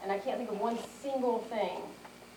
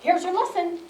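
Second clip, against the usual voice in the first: higher, louder announcing voice; visiting instructor; 9 semitones higher, 7 dB louder than usual. Acted. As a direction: lightly act out an instructor's announcement. Imagined instructor arriving at house.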